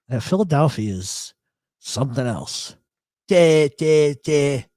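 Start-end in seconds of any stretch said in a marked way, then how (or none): 3.3-4.6 loud, drawn South Philly vowels; punchy mock Philly bleat; South Philly accent. Shown as a demonstration. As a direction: exaggerate the South Philly drawl. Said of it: "I can totally do the Philly thing".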